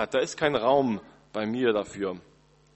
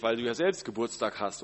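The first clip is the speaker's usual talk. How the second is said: normal talking voice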